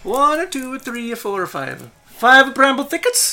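high, loud, perky goblin voice; high-pitched goblin vendor; friendly goblin ride-keeper. Acted. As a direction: pitch it well up like an eager little goblin hawking a ride. Goblin haggles over tickets.

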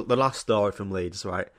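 ordinary talk

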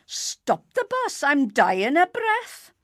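very high, slow drawn-out wail; desperate cry. A narrator's reading. Mister Weasley begging the bus to stop, comic desperate cry. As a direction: cry out high and loud, stretching it. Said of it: "cough like a billy goat and cry".

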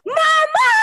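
high, loud call of Mama; retold shout for mama. Older brother suggests shouting for mama instead of searching, loud call. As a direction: yell it like calling mama from outside. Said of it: "isigaw mo".

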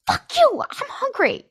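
very high-pitched outburst; hammed-up hangry retort; mock angry. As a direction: squeal it in comic indignation. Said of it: "Then I get mad at him".

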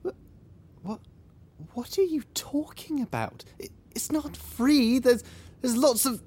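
stammer, lost for an answer; flustered bafflement. Acted. Resident baffled that she denies milkmen exist, flustered stammer.

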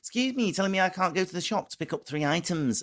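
lightly hammed-up offended partner; mock indignation. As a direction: act hurt and insulted. Mock-indignant retelling of a shop row.

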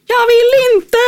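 high, loud shriek of refusal; mimicked shrieking protest; imitated child's scream. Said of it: "skriker han så här åt dem".

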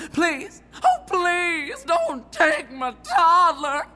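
hammy tearful high plea, slowing down; melodramatic pleading. Acted. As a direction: wail it like a silent film heroine. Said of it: "So imagine the scene. I'm stood here pleading".

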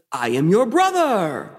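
slow, grand villain reveal; hammy dramatic pronouncement; Michael Baum Lucifer. Acted. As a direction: announce it slowly like a stage devil. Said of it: "my Michael Baum Lucifer".

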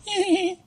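short high mock giggle; girlish giggle. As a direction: squeal out an imitation of giggling girls. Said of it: "you know, girls".

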